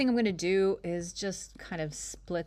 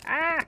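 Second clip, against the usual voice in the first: short, high, loud "ah!"; quick high yelp of alarm; mock scream; 11 semitones higher, 5 dB louder than usual. Presenter warning to unlock the track, short mock scream.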